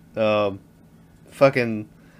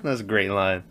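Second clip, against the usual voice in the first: normal talking voice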